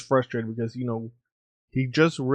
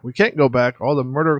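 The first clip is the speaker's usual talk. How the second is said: louder, urgent panic; raised voice insisting there's no way back; alarmed outburst; 2 semitones higher, 7 dB louder than usual. Acted. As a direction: blurt it out, cornered. Panicked character cornered by clones.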